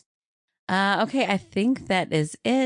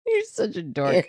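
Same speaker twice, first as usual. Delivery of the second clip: laughing, teasing; 10 semitones higher than usual. Real, not acted. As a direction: giggle through it. Teasing her sister.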